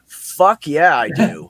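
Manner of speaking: a loud, delighted mock yell; eager yell. Brother agreeing to the search, loud eager yell. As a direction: blurt it out like an enthusiastic yes.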